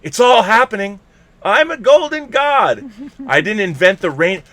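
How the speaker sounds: loud, high, hammed-up rock-star quotes; quoting movie lines. Quoting Almost Famous, belts the golden god line loud. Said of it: "how incredibly quotable it is".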